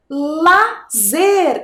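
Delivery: "lazer" split into two drawn-out syllables. Shown as a demonstration. Teacher helping first-graders find the missing syllable, syllable by syllable. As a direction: sound out "lazer" with a gap after "la". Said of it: "que sílaba que está faltando".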